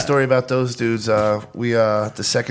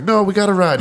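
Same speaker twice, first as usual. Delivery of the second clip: excited; 9 semitones higher, 4 dB louder than usual. Retold or imitated. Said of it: "the Thanatology guy's like".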